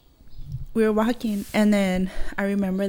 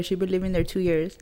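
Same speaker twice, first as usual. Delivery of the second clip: usual speaking voice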